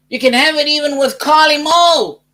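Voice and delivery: hammed-up beer jingle patter, building high and loud; beer advert parody. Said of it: "but the advert was there".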